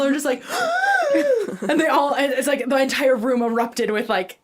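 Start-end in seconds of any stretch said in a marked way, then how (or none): 0.5-1.6 high gasping mock scream; imitated scream. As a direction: mimic a room of shrieking kids. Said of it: "They all scream".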